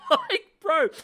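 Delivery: high cackling laugh into bro. Real, not acted. Laughing at the husband's meltdown, loud and high.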